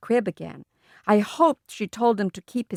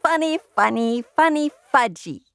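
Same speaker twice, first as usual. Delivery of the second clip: put-on giggly toddler; 5 semitones higher than usual. A narrator's reading. Toddler repeats word.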